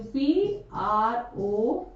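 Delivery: letters spelled one by one, very slowly; slow spelling. Shown as a demonstration. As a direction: dictate each letter of the spelling deliberately. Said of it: "देखो दोबारा लिखती हूँ". (अक्षर-अक्षर धीरे बोलकर spelling)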